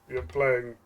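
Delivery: usual speaking voice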